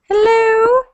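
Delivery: normal talking voice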